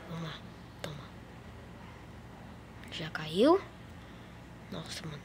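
tense hushed whisper. Real, not acted. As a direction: whisper it nervously. Tense moment in match.

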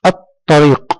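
الطريق split as att-tareeq, no L; silent lam. Shown as a demonstration. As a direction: stop on the doubled t of الطريق, then release. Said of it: "انتقلنا من الألف إلى الطاء". (نطق الطريق بطاء مشددة دون لام)

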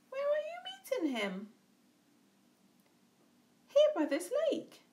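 high, alarmed snake. A narrator's reading. Snake questioning the mouse, high. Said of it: "away snake slid".

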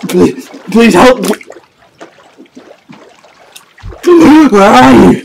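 high, loud desperate screaming; panicked screams. Acted. Man dragged into the sea, screaming for help. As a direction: scream for help as you go under.

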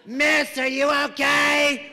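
high, loud, drawn-out busybody; loud concerned bystander. Retold or imitated. Lady at next table butts in, loudly alarmed. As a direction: call it out slowly and loudly to a stranger.

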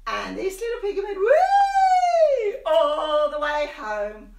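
high loud playful; excited squeal. Acted. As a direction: squeal the wee for the kids. Piggy runs home squealing.

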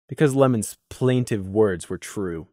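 ordinary delivery

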